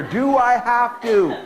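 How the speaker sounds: snotty voice. Shown as a demonstration. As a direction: play the bratty kid asking it again. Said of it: "One finger".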